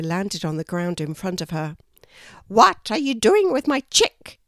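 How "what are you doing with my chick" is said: high, loud furious demand; angry. A narrator's reading. Mother kookaburra scolding the kangaroo near her chick, angry and loud. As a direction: confront the intruder fiercely. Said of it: "the kookaburra asked angrily".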